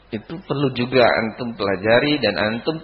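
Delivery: ordinary talk, nothing marked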